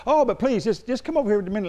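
retold pushy appeal, hurrying along; aggressive pleading. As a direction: imitate a pushy seller coaxing passers-by. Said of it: "he said she was real aggressive".

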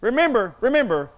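loud, emphatic sermon delivery; preaching. Real, not acted. Preaches at full force.

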